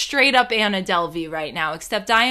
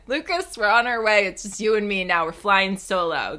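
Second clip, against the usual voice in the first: normal talking voice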